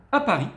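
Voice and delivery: Paris lifted high and loud; rising accent. Shown as a demonstration. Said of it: "donc accent, la voix qui monte".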